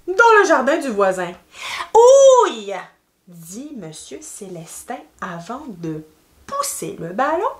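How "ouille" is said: high, loud mock ouch; acted cry of pain. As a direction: yelp as if a ball just hit you. Character hit by the ball.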